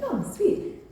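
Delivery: high-pitched, light "oh, sweet"; retold pleased reply. Actress answering her agent's call, lightly pleased. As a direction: casually chirp it, lightly imitated.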